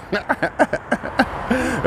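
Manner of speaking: high, soft run of chuckles; real chuckle. Real, not acted. Son amused by his mother's yelp, real chuckling. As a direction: laugh helplessly at your own impression. Laughs at his own impression.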